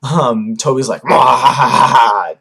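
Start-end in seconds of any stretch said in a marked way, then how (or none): 1.0-2.4 villain cackle. Retold or imitated. Toby villain laugh.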